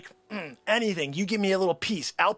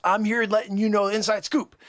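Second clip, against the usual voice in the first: usual speaking voice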